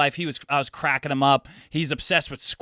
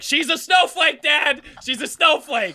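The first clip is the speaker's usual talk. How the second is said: high, mock yell at dad; hammed-up shout about the sister; 12 semitones higher, slower than usual. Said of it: "I was like".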